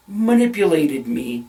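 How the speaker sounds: usual speaking voice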